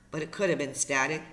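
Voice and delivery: plain reading voice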